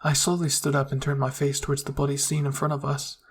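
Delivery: usual speaking voice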